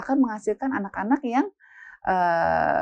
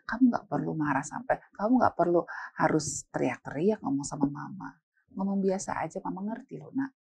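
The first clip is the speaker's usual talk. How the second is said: softer, lower, gently reasoning; calm parent talk; 4 semitones lower, 6 dB quieter than usual. Shown as a demonstration. Mama telling her child there's no need to yell, calm. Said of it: "ngomongnya seperti itu".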